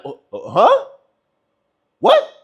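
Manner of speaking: stammering then a high squeaked what; mock stunned. Girlfriend caught off guard by calm reply, mock shocked squeak.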